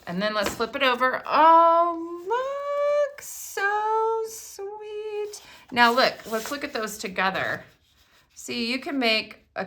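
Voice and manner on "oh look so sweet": drawn-out, much higher "so sweet"; high cooing. Real, not acted. Admiring a card to viewers, slow high coo.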